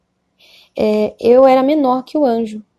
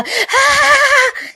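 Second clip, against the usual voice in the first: high, short anguished scream; desperate scream. Retold or imitated. Condemned woman falling to the floor, high desperate scream. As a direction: let out a cry of despair. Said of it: "De tanto que ela gritava".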